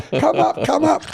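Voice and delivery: very high-pitched mock call from a window; imitated calling down. Woman two floors up to man in street, calling down.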